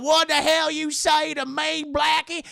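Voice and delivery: high, loud indignant yell; outraged shout. Retold or imitated. Overseer yelling at Solomon in outrage. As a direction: bristle and yell back.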